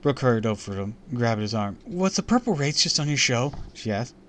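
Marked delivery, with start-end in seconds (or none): 1.9-3.5 higher, louder eager question; urgent curiosity; eager girl voice. Acted. Brooke voiced.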